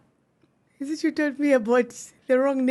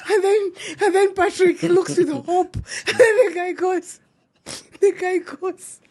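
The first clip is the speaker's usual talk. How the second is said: high, shaky voice laughing as she tells it; can't hold back the laughter; telling the story through helpless laughter; 6 semitones higher than usual. Real, not acted. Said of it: "Stu, it's not funny".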